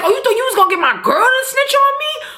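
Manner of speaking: very high, indignant mock threat; confrontational Tommy. Tommy confronting Sax over his girl, indignant. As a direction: act out an outraged confrontation.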